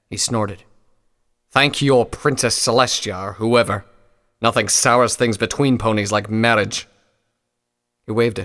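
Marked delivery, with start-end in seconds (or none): 1.5-6.9 loud, sour grumbling; a jaded old pony sounding off; grumpy old pony. Acted.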